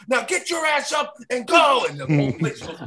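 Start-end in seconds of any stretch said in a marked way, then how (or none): 0.1-1.8 high, loud shouted order; mock yell. Doug heckling the cop to get up, loud high yell. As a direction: bark it at the cop.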